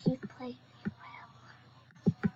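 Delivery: slow unvoiced whisper. Real, not acted. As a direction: murmur it slowly, no voice.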